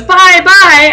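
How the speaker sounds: high, loud singsong farewell; cheerful goodbye. Retold or imitated. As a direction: wave goodbye brightly like the old man. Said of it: "he goes".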